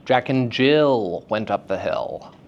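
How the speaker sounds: "Jill" held long on its vowel; elongated vowel stress. Shown as a demonstration. Teacher demonstrating stress by elongation, long vowel in "Jill". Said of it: "I just elongated the vowel sound".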